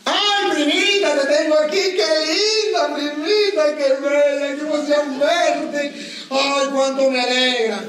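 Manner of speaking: high, slow, gushing delight; delighted cousin. Retold or imitated. Cousin gushing at her little cousin's photo, high and slow. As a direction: coo over a beloved photo in a high, drawn-out voice.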